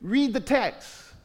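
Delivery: slow, each word weighed; slow emphatic plea. Real, not acted. Pastor urging the church to read, slow and earnest. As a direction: plead with them to read it. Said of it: "just seriously".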